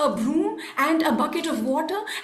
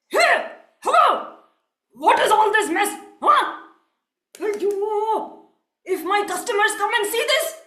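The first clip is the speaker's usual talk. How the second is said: angry yelling; 5 semitones higher than usual. Acted. Rich merchant to coolie about the mess, yelling. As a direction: yell it at someone who messed up your shopfront. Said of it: "stormed out of the shop and yelled".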